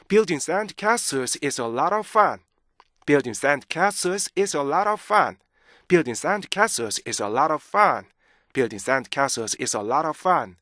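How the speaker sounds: connected reading, LOT OF flowing together; joined on short breath. Shown as a demonstration. Teacher modelling the final reading, chunks linked smoothly. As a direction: link the chunks into one smooth run. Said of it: "짧은 호흡을 이용해서".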